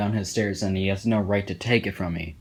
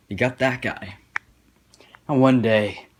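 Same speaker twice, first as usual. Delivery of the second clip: usual speaking voice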